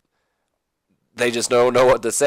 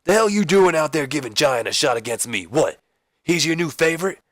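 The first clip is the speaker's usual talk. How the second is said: raised-pitch indignant demands; angry confrontation; 6 semitones higher than usual. Acted. Wrestler busts into the boss's office over a title shot, angry. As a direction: fire accusing questions at the boss.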